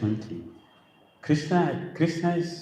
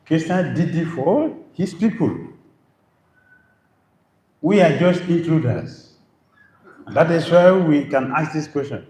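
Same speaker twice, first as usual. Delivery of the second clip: louder, higher forceful preaching; preaching at full force; 5 semitones higher, 8 dB louder than usual. Real, not acted. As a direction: drive it home to the crowd. Preaching rebuke.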